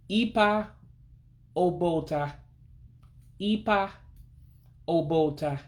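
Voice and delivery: ipa obota in separate chunks, ipa pitched high. Shown as a demonstration. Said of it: "real high-pitched I-like sound".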